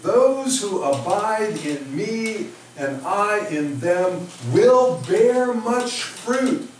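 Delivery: ordinary talk, nothing marked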